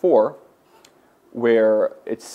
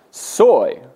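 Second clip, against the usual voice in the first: Soy punched higher and louder; emphatic Soy; 8 semitones higher, 6 dB louder than usual. Shown as a demonstration. Teacher stressing Soy to show Greek emphasis. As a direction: hit the Greek word Soy hard. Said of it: "is emphatic".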